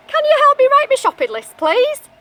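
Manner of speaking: high, loud begging; high pleading wolf voice. Acted. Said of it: "said Mister Wolf".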